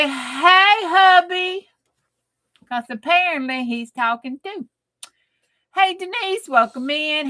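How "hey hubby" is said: teasing hubby greeting. Pretend. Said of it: "Y'all say".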